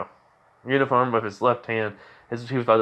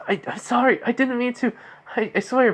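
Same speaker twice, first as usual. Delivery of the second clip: high-pitched, stammering plea; panicked apology; 12 semitones higher than usual. Retold or imitated. Girlfriend apologizes to furious boyfriend, pleading. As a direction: plead in a much higher voice, lightly acted.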